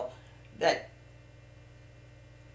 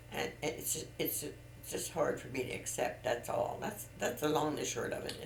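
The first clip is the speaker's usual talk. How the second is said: quietly hurt; 4 semitones lower, 9 dB quieter than usual. Real, not acted. Admits hurt, voice drops.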